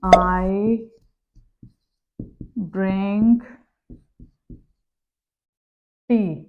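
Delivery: very slow with long pauses between words, as if writing; slow dictation. Shown as a demonstration. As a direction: give each word time while writing it. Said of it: "जैसे मैं यहाँ लिखती हूँ".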